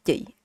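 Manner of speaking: "Yi" clipped and dry, no air; hard dry yi. Shown as a demonstration. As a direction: say "Yi" short with a hard start. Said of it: "It's very hard, very dry".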